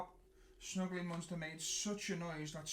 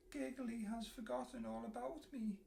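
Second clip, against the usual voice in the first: with a sniffle; 9 semitones higher than usual. A narrator's reading. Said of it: "he said with a sniffle".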